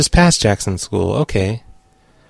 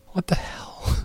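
whispered aside in disbelief; 8 semitones higher, 12 dB quieter, slower than usual. Real, not acted. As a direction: hiss it under your breath. Aside after hang-up.

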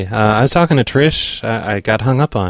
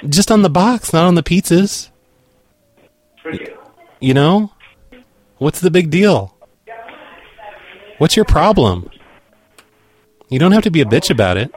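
indignant; 7 semitones higher than usual. Pretend. Arguing with customer.

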